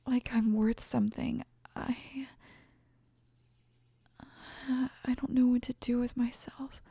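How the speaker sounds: unvoiced, hushed confession; whisper. Girlfriend whispering to the man who stayed. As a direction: barely let it out, overwhelmed.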